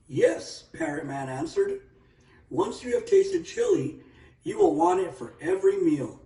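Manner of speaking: put-on high, lilting old chief. A narrator's reading. Old Parrot Man answering the young guest, high and lilting.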